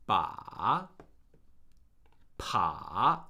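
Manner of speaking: "Ba" and "pa" dipping very low, then rising; third tone. Shown as a demonstration. Teacher drilling the third tone, low dip and rise on "Ba, pa". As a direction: drop each "Ba, pa" to the bottom and swing up. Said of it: "that is the third tone".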